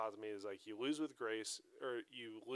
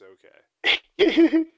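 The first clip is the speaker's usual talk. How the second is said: mock soothing; 23 dB louder than usual. Real, not acted. Mock calming overreacting coach.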